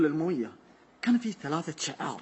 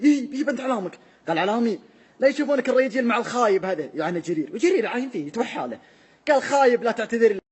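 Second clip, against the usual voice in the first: angry shouting; 5 semitones higher, 5 dB louder than usual. Retold or imitated. Father and son quarrel.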